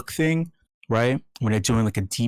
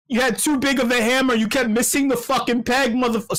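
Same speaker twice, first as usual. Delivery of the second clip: yelling; 11 semitones higher than usual. Real, not acted. Host yelling at Do Kwon during an ASMR bit, ranting. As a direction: rant at him, pitch way up. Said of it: "sorry, sorry. This is A S M R".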